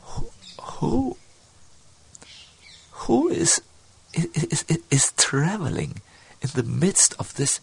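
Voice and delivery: wondering wife. Acted. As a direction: voice a puzzled wife, drawing out the words with long pauses. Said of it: "asked in a l-, voice which was heard by Rupa".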